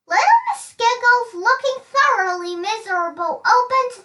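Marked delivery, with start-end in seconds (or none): none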